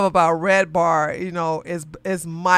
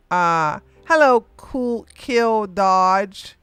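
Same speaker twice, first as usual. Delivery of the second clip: usual speaking voice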